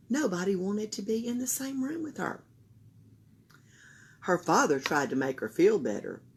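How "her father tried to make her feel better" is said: plain reading voice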